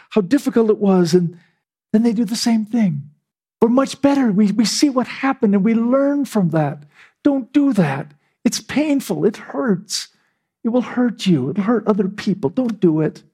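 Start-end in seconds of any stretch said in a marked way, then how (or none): 3.6-13.2 earnest preacher's plea; pleading sermon. Acted. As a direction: plead with the congregation. Pleading moral application.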